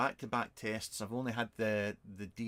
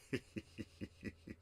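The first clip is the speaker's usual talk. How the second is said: quiet, wheezy giggle; 4 semitones lower, 13 dB quieter than usual. Real, not acted. Vlogger laughing after a bum joke, quiet giggle. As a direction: snicker softly at your own rude joke.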